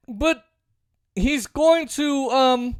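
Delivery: normal talking voice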